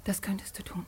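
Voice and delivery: cold, breathless whisper; bitter whisper. Acted. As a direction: dare him in a whisper. Daughter whispers bitter challenge.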